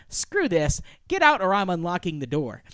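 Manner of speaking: loud, acted impatient threat; out of patience. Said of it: "she loses her patience".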